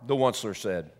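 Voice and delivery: ordinary delivery, nothing marked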